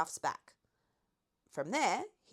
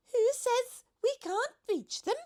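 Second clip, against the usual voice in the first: much higher, light giraffe voice; 5 dB louder than usual. A narrator's reading. The giraffe reminds the Duke of his invitation in a high voice. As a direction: play the lofty giraffe.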